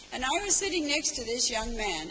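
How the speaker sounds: ordinary talk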